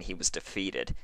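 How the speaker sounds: usual speaking voice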